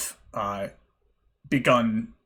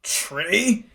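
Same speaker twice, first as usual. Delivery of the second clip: baffled question; 9 semitones higher than usual. Acted. Shopkeeper baffled by word.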